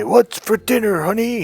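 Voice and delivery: scene: husband strolling into the kitchen asking his wife for dinner, mock macho; cocky husband. Hammed-up caveman husband. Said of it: "walk in the kitchen and say".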